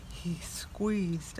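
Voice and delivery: whispered narration, holding the tension. A narrator's reading. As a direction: breathe it out secretly. Quiet tense milking moment.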